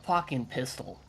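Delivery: ordinary delivery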